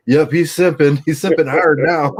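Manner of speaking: high, loud, gleeful mockery; teasing jeer. Friends jeering at a stranger from a bench. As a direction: rub it in, laughing. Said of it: "we're like".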